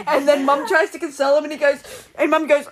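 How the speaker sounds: ordinary delivery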